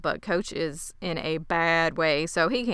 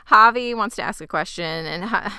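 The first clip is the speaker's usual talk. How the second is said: normal talking voice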